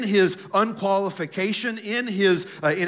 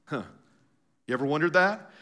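ordinary talk

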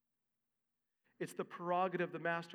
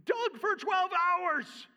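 higher, much louder, outraged whine; indignant workers complaining; 10 semitones higher, 8 dB louder than usual. Retold or imitated. Workers to the master about unfair pay, loud angry complaint. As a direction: protest like underpaid laborers who feel cheated. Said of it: "They're saying".

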